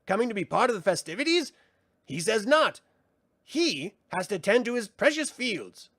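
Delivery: high, theatrical sprite voice; mocking brownie. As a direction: tease him with mock ceremony. Frangine mocks Willow.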